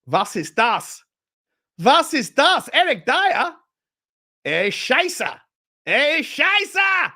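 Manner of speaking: put-on German-accented outrage, climbing high and loud; mock German fan. Host voicing German fans reacting to the Dyer news, hammed-up German accent. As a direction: ham up a baffled German Bayern fan, swearing. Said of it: "Or are they going".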